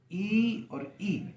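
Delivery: long stretched ee and short i; drawn-out vowel demo. Shown as a demonstration. Teacher showing students the two ee sounds, slow drawn demo. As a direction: stretch each vowel slowly to show the glide. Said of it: "तो".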